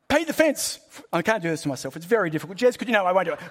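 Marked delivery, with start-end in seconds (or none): 0.1-0.8 mock sensei command. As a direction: belt out "Paint the fence" like a drill order. Acting out Miyagi's command.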